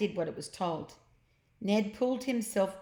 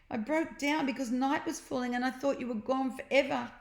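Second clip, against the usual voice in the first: higher, relieved sister; Jemima, relieved; 6 semitones higher than usual. A narrator's reading. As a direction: glad to find him. Jemima voiced.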